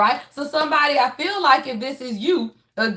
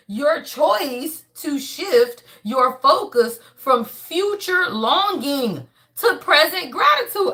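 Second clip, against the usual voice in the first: ordinary talk, nothing marked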